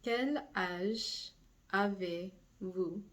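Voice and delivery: avez-vous drawn out slowly; slow it down for learners; slow model question. Shown as a demonstration. Teacher modeling the formal age question. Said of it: "start out with the formal vous".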